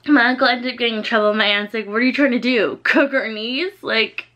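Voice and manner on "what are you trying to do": ordinary talk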